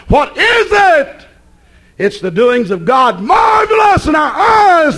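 high, slow shouted proclamation; preaching at full force. Acted. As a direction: cry it out to the congregation.

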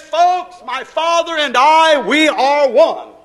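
loud, high, drawn-out preacher's declaration; booming proclamation. Retold or imitated. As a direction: proclaim it slowly at full voice. Preacher proclaims Jesus' words.

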